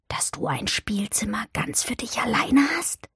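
hushed, curious whisper. A narrator's reading. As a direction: whisper the question softly. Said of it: "flüsterte Ermengarde". (leise flüsternd gefragt)

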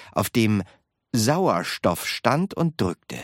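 ordinary delivery, nothing marked

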